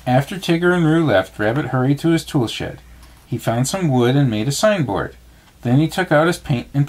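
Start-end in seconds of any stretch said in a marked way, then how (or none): none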